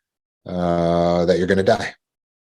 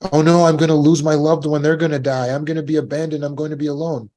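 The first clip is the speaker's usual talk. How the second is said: higher, louder frightened thoughts; mock fear; 6 semitones higher, 4 dB louder than usual. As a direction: voice the fear of being left. Acting out fearful inner voice.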